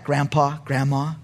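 ordinary delivery

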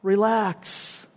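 soft, unhurried, laid-back calm; cool reassurance. Acted. Preacher as Boaz calming the gasping crowd, coolly reassuring. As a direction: settle them down quietly, with pauses. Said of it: "Boaz would look at us and just say".

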